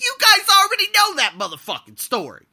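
venting to viewers about Thai bureaucracy, loud; exasperated outburst. Real, not acted. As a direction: explode at the bureaucracy.

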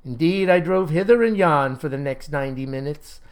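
ordinary delivery, nothing marked

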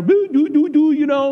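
put-on high, loud cooing baby talk. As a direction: goo at a newborn like a fool. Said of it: "we talk stupid".

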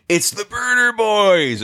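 high, slow, drawn-out happy shout of recognition; excited call. Retold or imitated. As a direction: call out the nickname across the tarmac, delighted. Pilot calling out on arrival.

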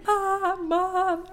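put-on high, drawn-out childish call; imitated child's yell. Flower girl calling for her mother down the aisle, imitated high wail. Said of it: "one of them was just yelling".

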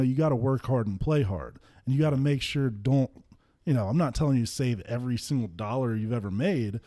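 ordinary talk, nothing marked

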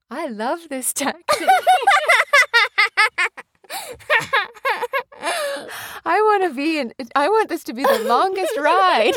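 high-pitched, gleeful mimicry of a kid; imitating her child. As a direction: play back the child's squeaky delight. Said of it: "And you go".

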